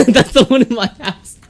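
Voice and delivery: real laughter over the words; laughing. Real, not acted. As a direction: crack up while saying it. Laughing hard.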